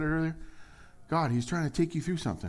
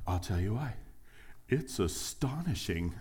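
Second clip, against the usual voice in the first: awed answer; 4 semitones lower, 7 dB quieter than usual. Acted. Acted answer to friend's question.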